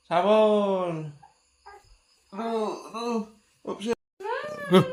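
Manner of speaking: usual speaking voice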